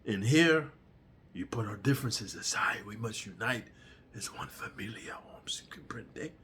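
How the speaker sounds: hushed Chicano gang boss; gangster whisper. Retold or imitated. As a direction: whisper the order like a prison boss. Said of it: "telling them".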